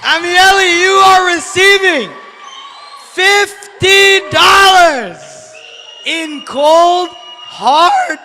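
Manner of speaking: high, loud drawn-out prize call; announcer shout. Real, not acted. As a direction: call the winner like a game show host. Announcing prize winner to crowd.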